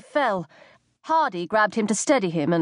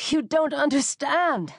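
slow, higher pleading; 6 semitones higher, slower than usual. A narrator's reading. Injured boy begging the commander to listen, slow plea. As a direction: beg him to listen, slowly. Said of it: "Mike pleaded".

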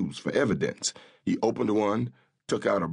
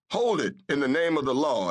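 shouted; 6 semitones higher than usual. A narrator's reading. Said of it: "a new voice shouted".

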